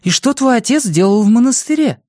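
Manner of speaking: high, loud, agitated demand; alarmed question. Acted. Mother demanding why her husband was at a monastery, agitated. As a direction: press him in shock.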